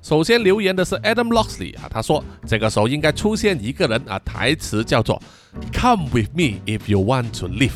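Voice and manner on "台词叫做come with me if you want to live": ordinary talk, nothing marked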